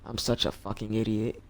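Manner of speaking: ordinary talk, nothing marked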